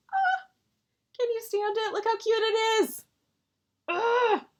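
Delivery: high, loud squeal of delight; excited gushing. Real, not acted. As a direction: squeal over something adorable. Gushing over tiny cute stitching.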